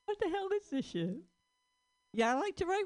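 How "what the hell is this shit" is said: puzzled squeak at the page; bewildered reading of old notes; mock baffled. Finding baffling stoned note.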